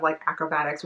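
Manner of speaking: usual speaking voice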